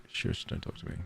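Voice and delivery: mock whispered Shush, don't talk to me; whispered brush-off. A contestant shushing the TV host, in a whisper. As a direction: hush them off in a whisper. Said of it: "someone would be like".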